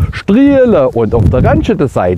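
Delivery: "strielen und auf der Ranche da sein" said slowly in broad Swabian dialect; swabian accent. Shown as a demonstration. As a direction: Swabian "strielen" drawn out, falling at the end. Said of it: "das erkläre ich jetzt nochmal alles ganz genau".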